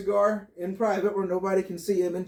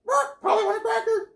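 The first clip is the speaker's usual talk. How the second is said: very high squawking parrot voice; 13 semitones higher than usual. Acted. Parrot talking in a joke.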